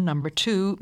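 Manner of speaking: normal talking voice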